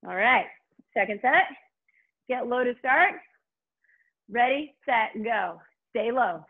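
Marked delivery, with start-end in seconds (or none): none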